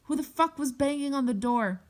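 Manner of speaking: high-pitched mock scream, held back in volume; restrained retold shriek; imitated scream. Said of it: "I hear her screaming".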